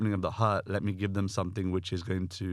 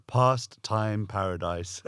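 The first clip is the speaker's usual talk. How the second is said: drawn-out American accent, slow and a bit louder; mock American accent; 4 dB louder, much slower than usual. Shown as a demonstration. Guest echoing the host's song title in an American accent. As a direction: stretch it out in a playful American accent. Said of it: "Don't know why I said that in an American accent".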